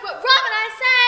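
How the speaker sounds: calling out. Acted. Calling servants again.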